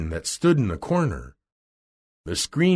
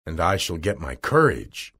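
low-pitched storybook lion; lightly voiced low lion; Cowardly Lion; 6 semitones lower than usual. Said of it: "said the Cowardly Lion".

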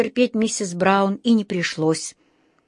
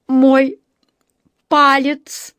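higher, louder, word by word; slow and separate; 6 semitones higher, 6 dB louder, much slower than usual. A narrator's reading. Dentist facing the patient who bit him, slowly and distinctly. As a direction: pause heavily between the words. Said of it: "медленно и раздельно произнёс".

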